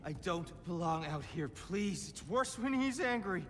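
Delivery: frightened pleading. Acted.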